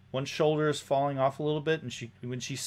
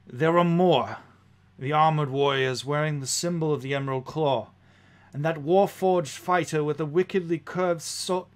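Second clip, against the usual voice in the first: raised, louder noble lady's warning; urgent noblewoman; 4 semitones higher, 5 dB louder than usual. Acted. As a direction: report the threat with pressing urgency. Game master plays Lady E warning.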